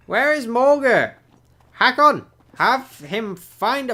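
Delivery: loud, high summons; commanding call. Acted. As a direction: call out over the docks. Prince calling for his man.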